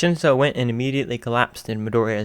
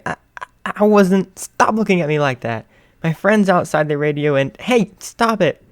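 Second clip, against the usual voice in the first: higher, louder stammering fluster; 8 semitones higher, 6 dB louder than usual. Acted. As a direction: get flustered and embarrassed. Flustered co-host.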